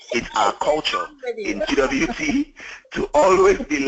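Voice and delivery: high giddy laugh into the words; laughing through it with delight. Real, not acted. Laughing with excitement.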